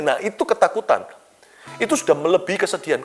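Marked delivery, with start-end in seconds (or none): none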